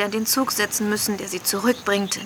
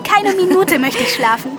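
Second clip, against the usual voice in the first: laughing through the words, pitched up; 8 semitones higher, 3 dB louder than usual. Acted. Eager girl refusing to sleep, laughing. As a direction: giggle out the promise not to sleep. Excited girl before the holidays.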